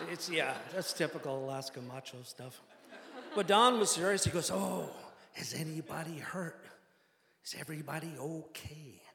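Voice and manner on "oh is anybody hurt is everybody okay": exaggerated Midwestern accent. Pilot checks on family after cabin fire, Midwestern accent. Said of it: "exaggerated Midwestern accent".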